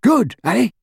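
high, cheerful burst; happy. A narrator's reading. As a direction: pipe up, pleased and high. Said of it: "said Ron happily".

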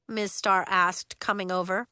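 ordinary delivery, nothing marked

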